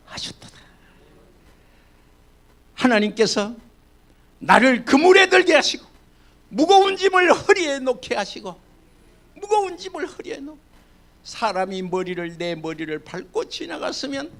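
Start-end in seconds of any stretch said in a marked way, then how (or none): 4.4-10.6 higher, louder scripture cry; fervent preaching. Acted. Pastor to congregation, reading the psalm in a raised preaching cry. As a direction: proclaim it with rising fervor.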